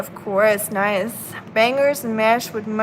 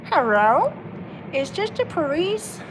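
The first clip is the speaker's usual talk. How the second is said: high, silly pet voice phoning the police; squeaky cartoon cat calling the cops; playful mock voice of the cat; mock Oscar voice; 6 semitones higher than usual. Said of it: "we mock Oscar's voice in this very weird way".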